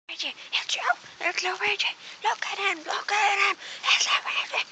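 very high-pitched excited squealing. Acted. Fan recognizing Luigi and pointing him out, excited.

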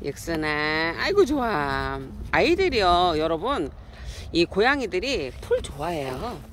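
ordinary delivery

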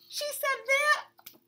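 scandalised disbelief; shocked gasp. Acted. Bunny hearing juicy gossip from Vern, high and slow.

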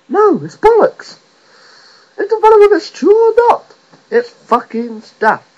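loud, high-pitched indignant outburst; exasperated rant. Real, not acted. Comic rejects the sob story, exasperated high shouting. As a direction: shout it down, voice shooting up. Ranting against sympathy votes.

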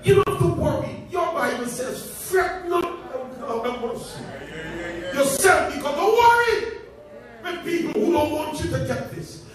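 loud, high, rising sermon cry; preaching at full force. Acted. Preacher shouting to the congregation, full-force preaching. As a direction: drive it home to the congregation.

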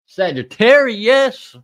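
very loud, high, stretched-out name; big drawn-out high shout; announcer call-out. Real, not acted. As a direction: holler the sign slowly like an announcer. Announces next sign.

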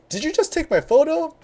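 high-pitched questioning voice; high, incredulous retold question; imitated confronting woman. Acts out woman confronting him.

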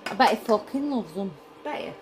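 ordinary talk, nothing marked

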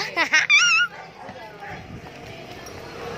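long, high-pitched burst of laughter; real high giggling laugh. Real, not acted. Laughing at own SM Nova joke.

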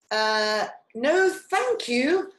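high, loud, hesitant no-thanks; wary refusal. A narrator's reading. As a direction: decline politely but uneasily. Chameleon nervously declines Snake's invitation.

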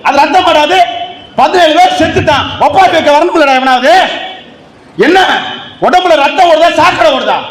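high-pitched, hammering rebuke; fiery defiant retort. Acted. Speaker answers those who expect obedience, fiery and defiant.

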